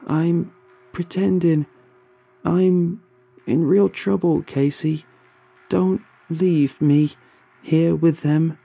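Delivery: desperate pleading. Acted. Carl begs not to be left.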